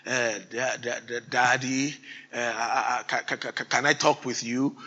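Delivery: soft, halting, stammering daughter; a hint of a nervous child asking; mildly imitated shy stutter; timid stutter. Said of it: "almost stuttering, begging".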